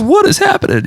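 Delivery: very high, squeaky disbelief; imitated bewilderment. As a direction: play an overwhelmed driver, voice shooting up. Driver bewildered, acted out.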